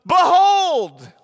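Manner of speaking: sudden high shout of Behold!; a preacher's sudden cry; screamed Behold. Retold or imitated. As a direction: scream it out of nowhere. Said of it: "he screams it out".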